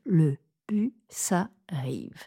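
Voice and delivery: Le bus arrive chuchoté en lé-bu-sa-rive; syllabes découpées chuchotées. Shown as a demonstration. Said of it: "découper les syllabes". (découpage des syllabes chuchoté, lent)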